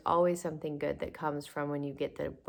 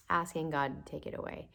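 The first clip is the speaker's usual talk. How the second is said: ordinary delivery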